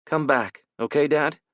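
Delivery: a small son clinging to his father, asking him to come back; young boy pleading. Acted. Son begs father to return.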